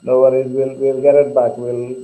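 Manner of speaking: normal talking voice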